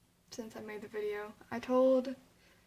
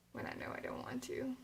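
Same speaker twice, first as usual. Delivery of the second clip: whispered; 3 semitones higher, 5 dB quieter than usual. Real, not acted. Private resolve about advisor.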